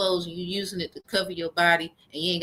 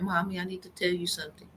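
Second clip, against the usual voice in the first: put-on soft, quick little-girl voice; a light impression of the daughter, quiet and hurried; imitating her daughter; 8 dB quieter, faster than usual. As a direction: mimic how her daughter always sounds. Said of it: "She always sound like that".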